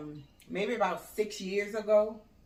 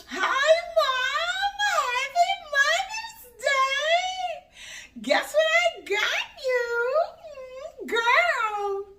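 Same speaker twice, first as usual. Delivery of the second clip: exaggerated high, slow squeal; proper-talking daughter; 6 dB louder, much slower than usual. Mom imitating baby girl bringing a gift, slow high proper squeal. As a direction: draw it out high and loud, mocking her proper talk. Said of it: "She talks so proper".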